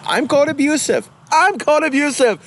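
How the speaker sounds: high, far-off mock voice; mocking whine. Vlogger mocking a YouTuber who calls himself abused, high mock whine. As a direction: whine it like a wounded victim.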